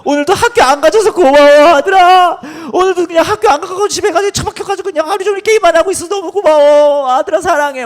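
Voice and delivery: very high, loud, gushing thanks; hammed-up ecstatic wailing praise; gushing affection. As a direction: play a father wildly overdoing his love. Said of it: "막 아들한테 안아주고 그런 거예요".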